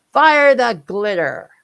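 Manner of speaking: loud, slow battle command; barked order. Acted. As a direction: bellow the order to the gunners. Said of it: "gave the order to the bombardiers".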